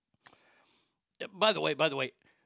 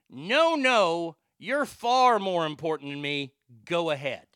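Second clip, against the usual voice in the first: mock deference; 7 dB louder, slower than usual. Customer letting a woman go first, loud mock deference. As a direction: emphatically wave her ahead, easing down on "go ahead". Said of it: "that's exactly how I said it".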